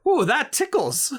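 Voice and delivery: high squeal, giggly and tickled; squeaky tickled voice. Retold or imitated. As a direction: squeal it like you've been tickled. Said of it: "We ended with".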